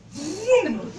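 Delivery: loud laughing car-engine vroom; mock vroom. Mother flooring it, a loud laughing vroom. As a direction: rev it like a car pulling off.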